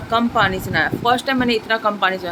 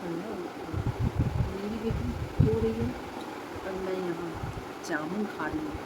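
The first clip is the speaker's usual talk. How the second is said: hushed; 2 semitones lower, 10 dB quieter than usual. Real, not acted. Talking to viewers beside sleeping daughter, very soft hushed voice. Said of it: "धीरे धीरे बोलती नहीं तो उठ जाएगी".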